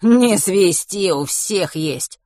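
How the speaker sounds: slow, drawn-out heavy thug; big thug voice. Acted. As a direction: scoff slowly like the big guy. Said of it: "отозвался третий, самый крупный".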